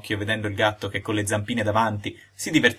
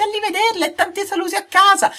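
very high, loud piping chick; a newborn chick waving goodbye; tiny piping bird voice; chirpy chick; 6 dB louder than usual. A narrator's reading. The chick's farewell quoted.

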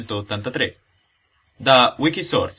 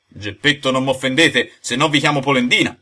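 loud, raised-pitch threat; indignant, louder caution; heated warning; 3 semitones higher, 6 dB louder than usual. Acted. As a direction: warn firmly in rising anger. Acted quarrel, warning threat.

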